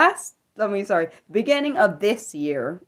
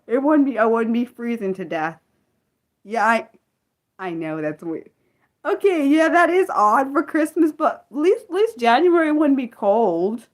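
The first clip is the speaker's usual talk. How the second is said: ordinary talk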